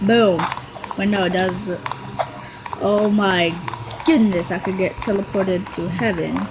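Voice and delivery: ordinary talk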